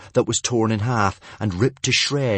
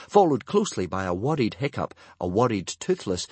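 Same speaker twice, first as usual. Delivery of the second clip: ordinary delivery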